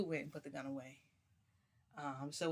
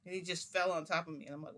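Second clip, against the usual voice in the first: usual speaking voice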